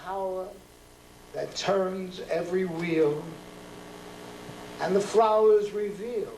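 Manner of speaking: slow, measured poem reading with long pauses; poetry reading. Acted. Poet reading his street poem to a live audience. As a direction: let each image land, then pause.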